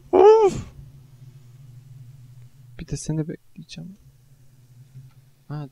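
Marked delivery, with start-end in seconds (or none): 0.1-0.7 piercing high wail of frustration; anguished cry. Real, not acted. Gamer howls in pain after another death. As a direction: let out a near-sobbing howl. Raging after failing again.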